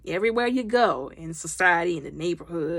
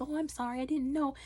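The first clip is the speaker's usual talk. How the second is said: small, soft, higher-pitched apology; meek apology; 5 semitones higher, 10 dB quieter than usual. Retold or imitated. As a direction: imitate someone apologizing timidly. Meek apology to the bossy woman.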